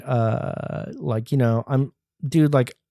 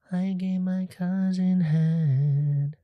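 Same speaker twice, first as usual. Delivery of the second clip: higher, very drawn-out put-on voice; slow high mock voice; 6 semitones higher, 5 dB quieter, much slower than usual. Vlogger repeats his punchline, slow and high. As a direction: sing it out slow and high as a joke.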